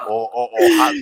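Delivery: put-on Puerto Rican accent. Imitating his Puerto Rican cousin, Puerto Rican accent. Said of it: "You switch from a Dominican accent to a Puerto Rican".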